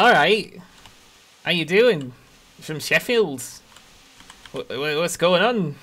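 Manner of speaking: a cheerful hammed-up Sheffield local; Sheffield accent. Shown as a demonstration. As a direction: play up a chatty Sheffield accent. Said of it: "So, so I have to sound like this".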